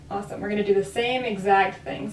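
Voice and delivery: normal talking voice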